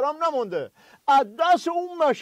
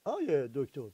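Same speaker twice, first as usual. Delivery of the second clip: hushed; 10 semitones lower, 14 dB quieter, slower than usual. Acted. Sidekick starts new topic.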